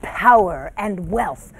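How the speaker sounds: loud, emphatic storyteller; dramatic story narration. Acted. Narrator describing the cruel, powerful emperor, dramatic storytelling. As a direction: project it like a stage tale, stretching the ending.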